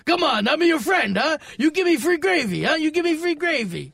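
hammed-up mock Greek accent; mocking imitation. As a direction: mimic the old Greek guy right back at him. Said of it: "Dave starts mocking right back to him".

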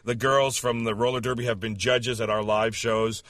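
ordinary talk

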